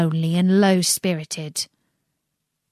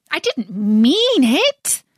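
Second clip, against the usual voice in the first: higher, louder, slow plea; pleading; 5 semitones higher, 5 dB louder, much slower than usual. Acted. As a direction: beg him not to be cross. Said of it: "pleaded poor Alice".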